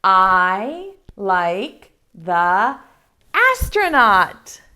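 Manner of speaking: very slow, each word drawn out; slow model reading. Shown as a demonstration. Teacher showing kids how to read along, very slow. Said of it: "I'll try one".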